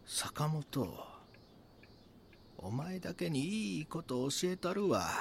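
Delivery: confiding. Acted. Confiding a secret to Sakamoto.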